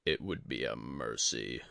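a dying sensei, calm and heavy; Yori the old master. A narrator's reading. Yori reminds Tadaka.

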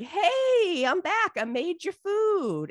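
high, hammed-up excited announcement; cheerful return. Son back from hunting to dad, eager high call.

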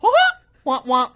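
very high cartoon mouse laugh; squeaky Mickey Mouse giggle. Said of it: "Why'd you do a Mickey Mouse laugh?".

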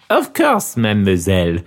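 mock French waiter, mademoiselle drawn down low; thick French accent. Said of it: "This is French".

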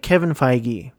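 Feige said as FYE-ghee; alternate pronunciation. Shown as a demonstration. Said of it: "or Feige".

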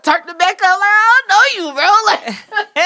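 high, loud retort with a laugh; acted protest. Acting out protest at the man.

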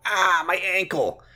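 high, slow mock cry of pain; acted pain. Retelling Declan on the stairs, mock pained wail. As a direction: yelp it like a hammed-up injury. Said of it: "so that Declan can go".

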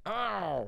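mock pained ow. Retold cop squeezing him.

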